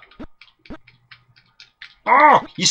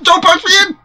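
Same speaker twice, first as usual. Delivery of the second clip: high, loud protest; real frantic yell; 14 semitones higher, 7 dB louder, faster than usual. Real, not acted. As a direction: cry out in sudden game panic. Calling out in frantic play.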